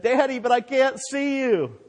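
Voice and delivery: put-on high-pitched child's protest; protesting boy. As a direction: plead with daddy like a scared boy. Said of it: "the boy protested and said".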